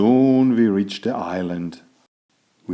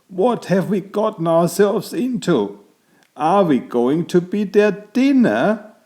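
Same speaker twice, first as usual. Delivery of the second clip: worried captive; 9 semitones higher than usual. A narrator's reading. Captured friends fearing they will be dinner, much higher. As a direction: fret about being eaten. Captives fear being eaten.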